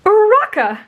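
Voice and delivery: high, loud delighted call; happy greeting. A narrator's reading. Boy happily greets his friend, high and loud. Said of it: "happily greeting her".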